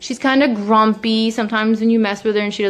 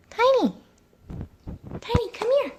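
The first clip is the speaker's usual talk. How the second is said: high sing-song call; calling the cat; 14 semitones higher, 5 dB quieter than usual. Real, not acted. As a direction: coax him over, high.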